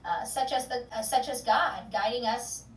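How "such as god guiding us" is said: ordinary delivery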